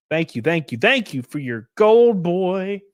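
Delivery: high, building, drawn-out gratitude; showy thanks. Real, not acted. Streamer thanks Donna for her donation, effusive. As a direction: stretch the last words out with a flourish.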